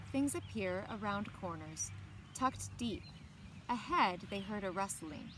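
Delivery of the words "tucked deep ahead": ordinary delivery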